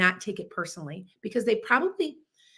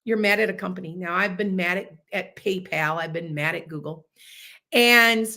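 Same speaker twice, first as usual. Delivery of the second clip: ordinary delivery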